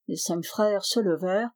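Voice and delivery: ordinary delivery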